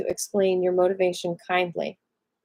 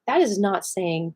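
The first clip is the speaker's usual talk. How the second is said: usual speaking voice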